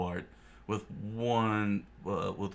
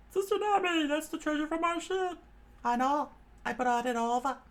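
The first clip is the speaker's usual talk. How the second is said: much higher, cautioning; high warning voice; 3 dB louder than usual. Acted. Johnny and Yosaku warning Nami about a pirate, high. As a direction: warn her off that pirate.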